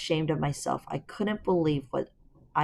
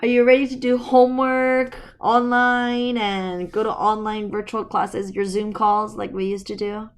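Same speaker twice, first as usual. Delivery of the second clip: playful teasing; 6 semitones higher, 8 dB louder than usual. Real, not acted. Teasing child about school.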